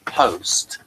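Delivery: ordinary talk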